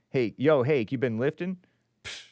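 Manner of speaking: usual speaking voice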